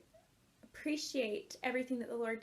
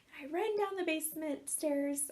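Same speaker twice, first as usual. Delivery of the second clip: ordinary talk, nothing marked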